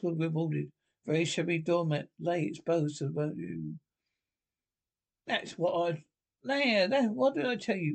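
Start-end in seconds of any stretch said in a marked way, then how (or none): 5.3-7.9 high, triumphant exclamation; great triumph. A narrator's reading. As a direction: crow in triumph. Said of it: "exclaimed the Rat in great triumph".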